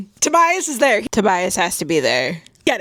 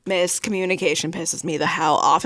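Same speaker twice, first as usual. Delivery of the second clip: usual speaking voice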